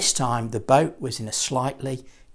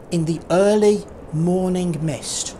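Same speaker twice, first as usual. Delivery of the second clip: usual speaking voice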